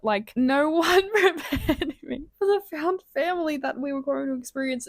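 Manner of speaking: slowed words breaking into a laugh; laughing through tears. Real, not acted. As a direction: laugh while half crying over the book. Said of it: "I'm tearing up ... and then I'm laughing".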